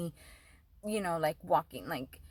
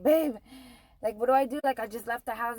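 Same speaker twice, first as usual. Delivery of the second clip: retold panic; 3 semitones higher, 4 dB louder than usual. Panicked call to boyfriend.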